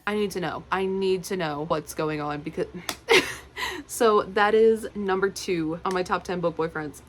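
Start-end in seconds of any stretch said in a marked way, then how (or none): 2.7-3.8 short high laugh; a bright giggle at herself. Real, not acted. Reader laughing at her own rant, high giggle.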